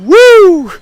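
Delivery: high, loud Ric Flair woo; wrestling woo. Acted. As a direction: let out a wild wrestling whoop. Wrestling promo whoop.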